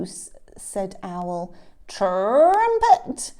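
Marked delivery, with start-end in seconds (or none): 1.9-3.3 high, loud trilled blast; elephant trumpeting. Acted. Elephant blowing his new nose, trumpeting. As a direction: blare the word like a trunk.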